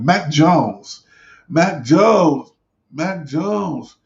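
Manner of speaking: MAC JONES stretched slowly with a swooping rise and fall; drawn-out name call. Shown as a demonstration. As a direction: sing out "Mac Jones" slowly, pitch arching high then dropping. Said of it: "You gotta say it like that".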